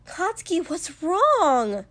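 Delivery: high, distressed plea; pleading. A narrator's reading. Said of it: "Mina pleaded".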